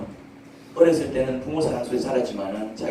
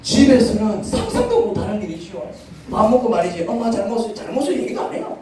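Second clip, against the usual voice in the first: preaching loud; 8 semitones higher, 6 dB louder than usual. Real, not acted. Preacher rising loud and high.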